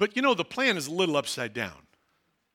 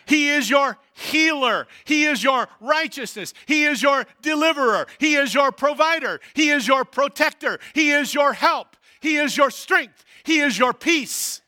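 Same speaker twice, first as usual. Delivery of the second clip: loud rising litany, hitting each "He"; preaching at full force; 5 semitones higher, 5 dB louder than usual. Acted. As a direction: proclaim it to the congregation, building.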